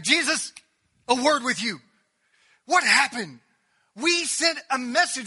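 loud, high, acting out an upset sister; angry confrontation. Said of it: "and I'm just mad".

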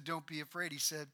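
Jesus softly calming his followers; tender comfort. Acted. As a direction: speak it gently, almost hushed. Jesus quoted comforting disciples.

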